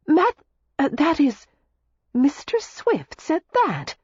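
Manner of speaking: high, halting, slowing disbelief; astonished. A narrator's reading. Daisy hearing what Mister Swift said of her, astonished. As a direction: stumble over the name in astonishment. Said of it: "Daisy stared at him in astonishment".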